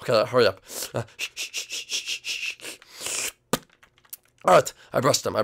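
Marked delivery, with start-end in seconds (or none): 0.9-3.3 rhythmic hushed "shh" scrubbing; toothbrushing noise. Acted. Son brushing teeth before date, imitated with soft shushes. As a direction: scrub away with repeated voiceless shushes.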